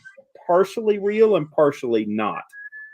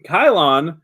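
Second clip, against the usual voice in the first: ordinary talk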